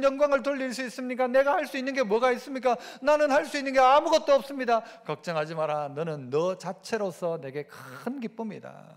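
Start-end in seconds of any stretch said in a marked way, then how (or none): 5.1-8.8 tender God's voice. Acted. God speaking tenderly to a child.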